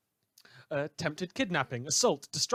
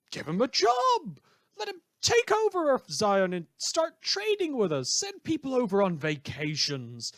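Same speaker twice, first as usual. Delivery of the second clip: mock incredulous; 9 semitones higher, 4 dB louder than usual. Sarcastic host mocking villain amnesty.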